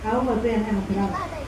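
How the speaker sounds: plain reading voice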